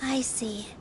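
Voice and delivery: soft unvoiced whisper. Friend softly answering Inaya's painful memory, whispered. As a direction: breathe it out gently under the breath. Quiet reply to a painful story.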